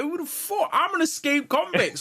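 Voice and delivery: high, hyped-up boast; excited high yell. Retold or imitated. Retelling a game fight, high and hyped. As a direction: pitch it up with glee. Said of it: "I was like".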